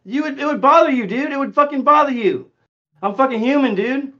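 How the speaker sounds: angry yelling. Real, not acted. Heated argument in voice chat.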